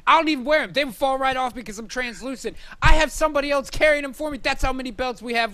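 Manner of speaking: wrestling promo boast. Pretend.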